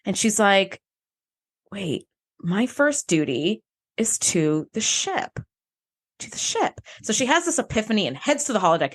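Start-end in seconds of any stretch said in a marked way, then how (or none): 1.7-5.4 slow, dawning words; slow dawning realization. Retold or imitated. Her sudden epiphany. 6.2-6.8 whispered to herself; whispering the echo in wonder; whispered realization. Retold or imitated. Her sudden epiphany.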